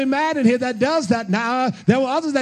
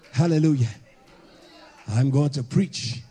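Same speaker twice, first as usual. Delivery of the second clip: usual speaking voice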